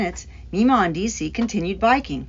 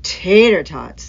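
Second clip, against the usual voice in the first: muttering; 6 dB louder, slower than usual. A narrator's reading.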